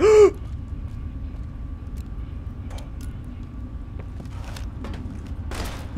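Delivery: high breathless panicked gasp; long terrified gasp. Real, not acted. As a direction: gasp in horror. Terrified in fight.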